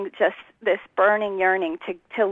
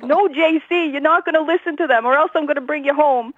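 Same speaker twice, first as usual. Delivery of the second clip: imitating her mother's thick Jersey accent, higher and fast; mock Jersey mom laying down the law, higher and quick; 7 semitones higher than usual. Mother warning daughter off Baptists, in a hammed-up Jersey accent. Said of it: "she said it with her thick Jersey accent".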